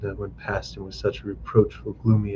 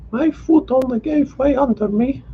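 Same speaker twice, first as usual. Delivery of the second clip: high voice, lightly reassuring; young wife. A narrator's reading. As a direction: brush it off gently, pitched high. Liza reassures her husband.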